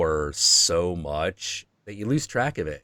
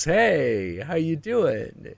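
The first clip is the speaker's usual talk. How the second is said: devil voice; 8 semitones higher, much slower than usual. Retold or imitated. Devil character greeting Jung.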